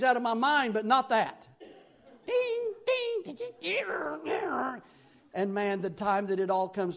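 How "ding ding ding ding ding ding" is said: high ringing notes falling away; high-pitched instrument noise, winding down; imitated ding-ding. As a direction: squeal out tinny little dings. Mimics the instrument in his head.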